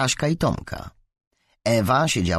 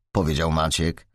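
ordinary delivery

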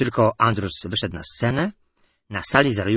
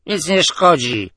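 loud, slow, higher tipsy reply; drunken blurt; 7 semitones higher, 7 dB louder, slower than usual. A narrator's reading. Staggering drunk answering the admiral, slow and loud. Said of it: "zachwiał się ... wypalił".

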